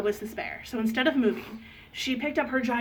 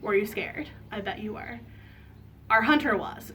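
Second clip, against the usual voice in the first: ordinary delivery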